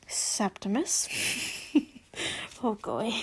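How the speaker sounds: soft, calm and gentle. Acted. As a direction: soothe him quietly. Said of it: "said in a calm tone".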